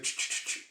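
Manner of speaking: unvoiced "ch-ch" to call a cat; cat-luring clicks. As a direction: coax a cat over with tongue noises. Trying to get, like, lure a cat.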